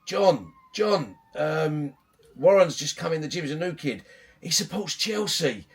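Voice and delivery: put-on high, loud call to a kid across the room; calling across the gym. Coach calling to a regular kid about the newcomer, loud playful shout. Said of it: "I would shout to one of the bigger kids".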